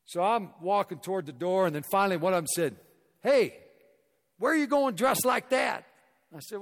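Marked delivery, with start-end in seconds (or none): 3.2-5.9 loud, higher shout across the lobby; doorman calling out. Retold or imitated. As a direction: call out to a guest heading for the door. Doorman calls out to speaker.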